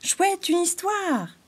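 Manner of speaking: high, slow delighted cry; excited squirrel cry. A narrator's reading. The squirrel cries out for a story, high and delighted. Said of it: "s'écria l'écureuil chafouin".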